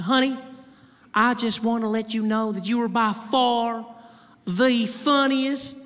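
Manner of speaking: high Southern drawl, stretched slowly at the end; Southern belle accent. Retold or imitated. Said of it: "A classic Southern belle".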